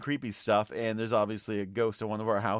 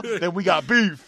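mock tough comeback; 6 semitones higher, 8 dB louder than usual. Playful tough reply.

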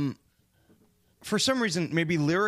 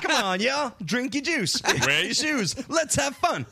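higher, hyped-up pop singer hype-man voice; mock party anthem; 8 semitones higher than usual. Parodying the album's pop star for friends, mock hype. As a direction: chant it like a cheesy club hook.

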